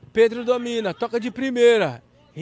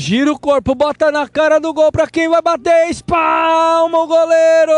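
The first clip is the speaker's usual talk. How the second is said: loud, rising call to the save; excited commentary; 6 semitones higher, 6 dB louder than usual. Real, not acted. Save called live.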